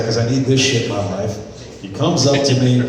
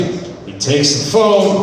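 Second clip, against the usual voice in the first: ordinary talk, nothing marked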